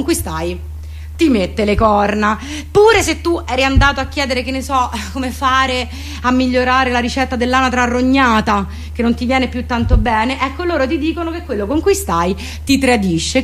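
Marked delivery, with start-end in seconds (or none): none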